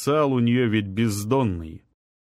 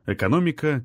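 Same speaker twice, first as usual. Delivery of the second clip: usual speaking voice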